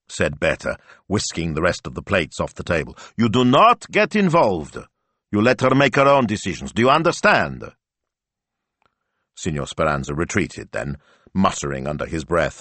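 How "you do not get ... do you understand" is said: high, loud, emphatic orders; forceful scolding, raised voice; stern warning. A narrator's reading. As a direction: lay down the law firmly. Wife lays down the law.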